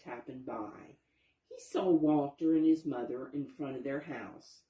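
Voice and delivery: ordinary delivery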